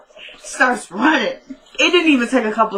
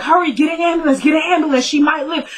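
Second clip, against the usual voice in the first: urgent mock shout; 7 semitones higher than usual. [name] faking urgency.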